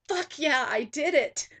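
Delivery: loud, high shout of victory; triumphant yell. Retold or imitated. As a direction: hands in the air, yell it out. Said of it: "I yelled out".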